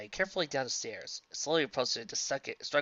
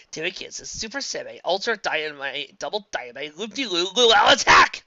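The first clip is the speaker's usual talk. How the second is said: attack call building to shout; 4 semitones higher, 10 dB louder than usual. Acted. Character shouting attack names.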